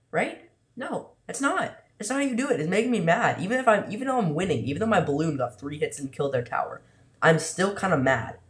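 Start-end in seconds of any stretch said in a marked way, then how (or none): 0.1-4.1 high-pitched exasperation. Real, not acted. Frustrated rant at opponent.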